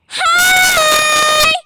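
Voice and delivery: high piercing shout of hi; screamed hi. Retold or imitated. [name] re-enacts screaming hi at Baynett, very loud and high. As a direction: blast a squeaky hi right in his face. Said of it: "why did you scream into my face".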